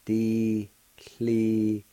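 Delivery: "Diklilostana" slowed, every syllable given; slow model word. Shown as a demonstration. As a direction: sound out "Diklilostana" carefully. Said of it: "It's pronounced like this".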